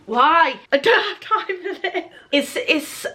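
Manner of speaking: high, choked-up protest; fighting back tears, breath catching; real tearful upset. Real, not acted. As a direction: voice cracking as tears come. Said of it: "It's the pressure of the filming".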